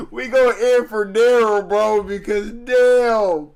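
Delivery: loud slow words through laughter; laughing loudly, drawn out. Real, not acted. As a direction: drag it out laughing.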